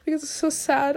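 high, tearful crying voice; sobbing through the words. Real, not acted. Crying to the camera about feeling sad.

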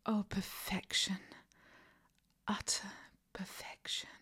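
ordinary talk

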